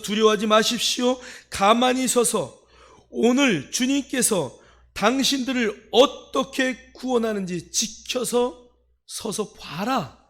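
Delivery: loud, high, slow proclamation; commanding. Retold or imitated. Moses ordering the fearful people to watch, loud command. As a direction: belt out each phrase as an order. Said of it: "명령합니다".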